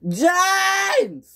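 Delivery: very high, loud far-off cry; screamed. Acted. Gordon screaming at James about his coaches. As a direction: bellow his name in alarm. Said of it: "screamed Gordon".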